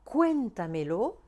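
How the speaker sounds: cuéntamelo slow, stressed on CUÉN; drawn-out "cuéntamelo", weight on CUÉN, the rest trailing. Shown as a demonstration. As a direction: hit the first syllable of cuéntamelo. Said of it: "mettre un accent sur le E".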